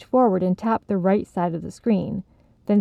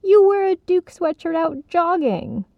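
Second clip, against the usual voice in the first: high indignant retort; defensive; 10 semitones higher than usual. Acted. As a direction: fire back at her. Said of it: "Sylvie defended".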